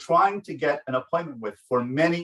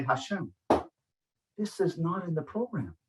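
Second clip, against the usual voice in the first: soft plea; 5 semitones higher, 7 dB quieter than usual. Retold or imitated. Pleading to Hashem.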